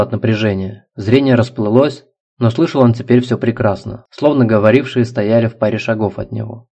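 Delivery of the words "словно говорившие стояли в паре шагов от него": plain reading voice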